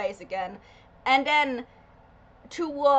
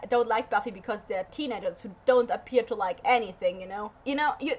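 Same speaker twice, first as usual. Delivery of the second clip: ordinary talk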